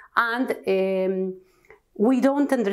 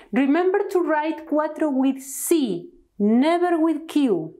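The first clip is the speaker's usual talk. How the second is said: ordinary talk, nothing marked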